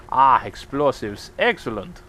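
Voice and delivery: put-on half-pirate Russian accent, drawn out; a shaky go at a Russian accent villain; lightly put-on Russian accent; attempted Russian accent. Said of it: "don't even think that was a Russian accent".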